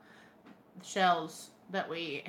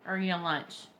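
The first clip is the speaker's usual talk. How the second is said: normal talking voice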